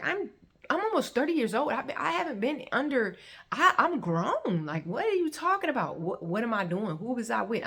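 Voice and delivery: high, indignant stammering protest; angry. Retold or imitated. Grown woman answering his questioning, angry and indignant. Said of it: "I was very angry".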